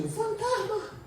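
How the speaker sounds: shrill, very high cry of fright; frightened cry. Retold or imitated. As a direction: squeal it like the terrified disciples. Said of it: "lo ven a Jesús y dicen".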